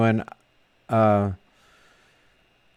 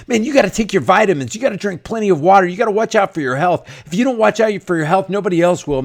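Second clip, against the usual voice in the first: higher, lecturing mock health advice; mocking preachy smoker; 6 semitones higher, faster than usual. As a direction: parody a vaping friend preaching about health. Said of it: "but they're telling you".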